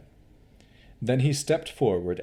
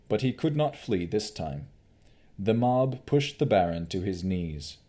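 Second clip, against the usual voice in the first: usual speaking voice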